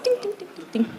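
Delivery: tiny, soft tinkling dings; ping lightly, very softly; imitated heel clicks. Comic imitates heels on tiles, dainty quiet dings. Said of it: "another woman walks in".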